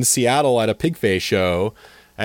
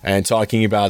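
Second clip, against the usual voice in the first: usual speaking voice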